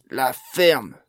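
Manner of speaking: loud, thundering order. A narrator's reading. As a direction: boom it out to silence her. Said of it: "tonna le meneur".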